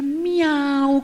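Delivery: a high, lightly sung mock meow; cat's best meow. Cat courting the cockroach with a lightly voiced meow. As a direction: meow sweetly to win her over. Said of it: "con su mejor voz".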